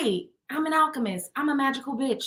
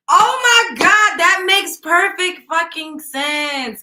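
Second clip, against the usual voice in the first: sudden realization; 8 semitones higher, 9 dB louder, much slower than usual. Real, not acted.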